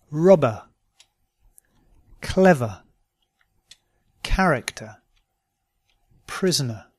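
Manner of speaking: "Mother" as "muh-thuh", R silent; British model words, E R said "uh" as in "Dinner"; non-rhotic schwa ending. Shown as a demonstration. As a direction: end "Robber" on a short schwa, no R. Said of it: "Words ending in E R".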